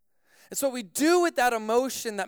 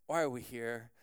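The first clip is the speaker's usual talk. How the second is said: low, soft, uneasy question; hushed retold dismay; quiet dismay; 9 semitones lower, 9 dB quieter than usual. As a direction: ask your friend under your breath.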